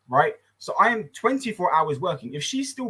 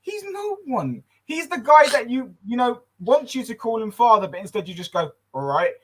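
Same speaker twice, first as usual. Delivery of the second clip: excited scoff; 7 semitones higher than usual. Retold or imitated. Telling niece off stepdad.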